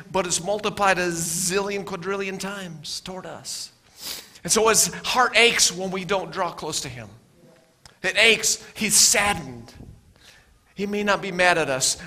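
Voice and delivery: ordinary delivery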